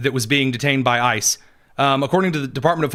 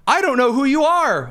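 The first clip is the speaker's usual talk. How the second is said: higher mock yell, read off the post; mock witness yell; 10 semitones higher than usual. Host reading a witness quote aloud, mock yell. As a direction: play up the witness's panicked yell. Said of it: "witness yells".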